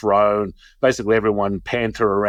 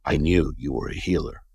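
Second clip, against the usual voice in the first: lower, knowing mother voice; imitated puffing mother; 6 semitones lower, 4 dB quieter than usual. Girlfriend's mother declaring him a healer, low knowing voice. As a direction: drop low, as if after a drag. Mother repeats it after another puff.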